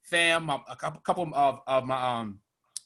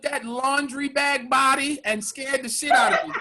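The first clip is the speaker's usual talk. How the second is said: slow, high, talking through laughter; laughing through the story; laughing while telling; 5 semitones higher, slower than usual. Real, not acted. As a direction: laugh it out as you tell.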